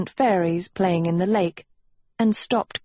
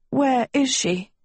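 higher, strained croaked question; worried, croaky higher voice; weak croak; 6 semitones higher than usual. A narrator's reading. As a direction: croak it out anxiously. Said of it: "Agatha croaked".

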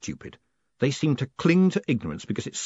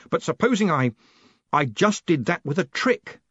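raised, slowing, desperate suggestion; 7 semitones higher, 3 dB louder than usual. A narrator's reading. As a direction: venture it desperately. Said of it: "said Moist desperately".

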